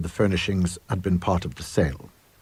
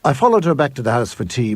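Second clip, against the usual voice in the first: ordinary delivery, nothing marked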